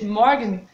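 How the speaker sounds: Morgen with the R almost an A, German style. Shown as a demonstration. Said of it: "c'est pas Morgen avec un R comme on le fait à la française".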